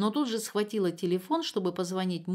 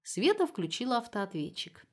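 ordinary delivery

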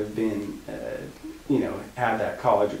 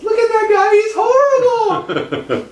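very high, loud falsetto screech; imitation of an appalled girl; mock horrified shriek; 12 dB louder, slower than usual. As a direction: scream in hammed-up horror, stretching the end. Friend's horror imitated in falsetto.

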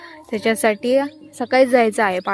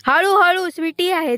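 coaxing call; 8 semitones higher than usual. Real, not acted. Telling dog to go slowly.

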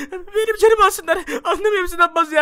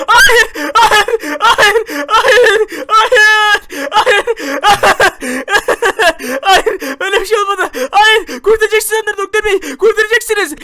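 loud high wailing through sobs; father howling in grief at the news; sobbing scream; 4 semitones higher, 8 dB louder, much slower than usual. Acted. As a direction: scream no at the doctor, breaking down. Grieving father told family died.

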